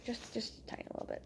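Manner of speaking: quick, tiny whisper; whispered aside. Real, not acted. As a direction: shrink it down to a hurried whisper. Playfully minimising the joke.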